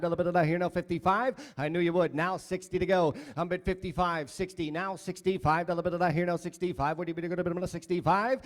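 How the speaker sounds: fast rhythmic auction chant; auctioneer. Acted. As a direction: rattle off the bids in a singsong.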